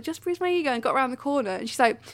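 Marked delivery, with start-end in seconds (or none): 0.0-0.7 lightly voiced, higher breezy quip; mock-casual dismissal to the onlookers; brushing it off. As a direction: toss it off casually, a little softer. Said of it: "then I did my bit to those people".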